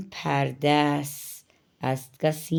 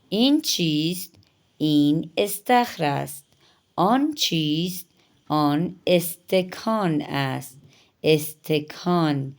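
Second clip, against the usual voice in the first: ordinary talk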